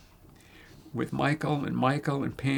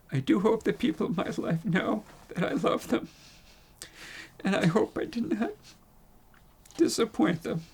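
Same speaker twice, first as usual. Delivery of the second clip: struggling to hold it together; faltering tender eulogy; 7 semitones higher than usual. Real, not acted. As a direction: voice breaking with emotion, pitched up. Hoping he did not disappoint loved ones.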